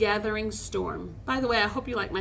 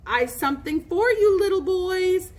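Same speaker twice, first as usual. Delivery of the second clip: put-on raised, loud girlish calling; shrill, girlish voice; 8 semitones higher, 5 dB louder than usual. A narrator's reading. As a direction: taunt them like a little girl. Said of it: "calling in a shrill, girlish voice".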